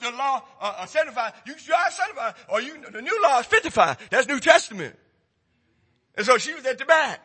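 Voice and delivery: loud, driving pulpit preaching; preaching at full force. Acted. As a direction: hammer it home to the congregation.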